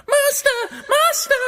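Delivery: very high, loud falsetto cry. A narrator's reading. Harp set down calls out to master, high squealing cry. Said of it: "and he cried".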